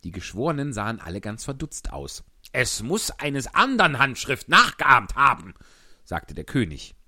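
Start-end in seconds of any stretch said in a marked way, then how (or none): 2.5-5.5 loud, high blustering king; pompous king. Acted.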